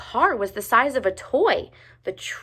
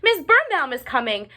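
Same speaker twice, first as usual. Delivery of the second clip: higher, louder alarm call; shouting; 6 semitones higher, 3 dB louder than usual. A narrator's reading. Ruby warning the others the teacher is coming. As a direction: raise the alarm. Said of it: "shouted Ruby".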